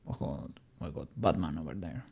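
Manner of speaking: deep, quiet Batman growl. Acted. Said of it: "I'm gonna see if you can get Batman voice".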